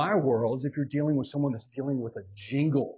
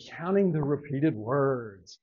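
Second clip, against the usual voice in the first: normal talking voice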